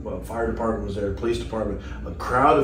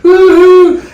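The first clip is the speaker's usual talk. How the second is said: very high, loud party whoop; wild whoop; 17 dB louder than usual. Retold or imitated. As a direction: let out a crazy cheer. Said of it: "He's like".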